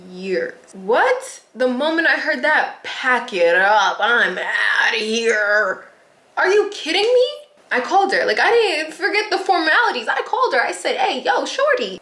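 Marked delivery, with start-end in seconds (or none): 2.8-5.9 long drawn-out, slow declaration; very slow, hammed-up sign-off; dramatic exit. Mock announcement of walking out. 6.4-12.0 high-pitched, worked-up exclaiming; animated, incredulous high voice; outraged disbelief. Retold or imitated. Outraged retelling of calling supervisor.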